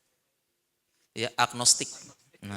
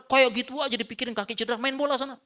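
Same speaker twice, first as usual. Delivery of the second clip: mock dismissive advice; 11 semitones higher, 5 dB louder, faster than usual. Menirukan nasihat yang keliru.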